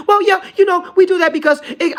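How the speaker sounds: high, loud, whiny mock of a know-it-all; ridiculing high voice of people defending it; mocking dumb voice. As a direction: mimic them as a clueless fool. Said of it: "You hear some of the dumbest stuff like".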